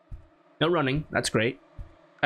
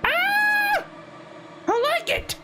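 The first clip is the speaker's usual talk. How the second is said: startled scream; slower than usual. Real, not acted. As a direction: scream as the scare hits. Jump scare at blood.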